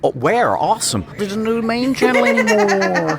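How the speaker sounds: high-pitched, hammed-up American accent; caricatured American fan accent. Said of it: "But I'd have to do an American".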